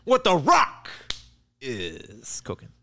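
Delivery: the Rock impression. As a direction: ham up the wrestler catchphrase.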